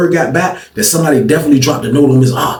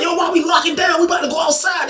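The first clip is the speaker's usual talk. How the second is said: high-pitched inmates' complaint; imitated objection, pitched way up; acted protest; 5 dB quieter than usual. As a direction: protest the lockdown in a raised, high voice.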